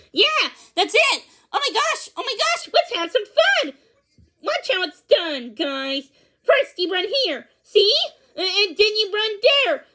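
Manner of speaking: a wild pup showing everyone how to play; overjoyed hyper puppy. Acted. Pugsley shows the dogs.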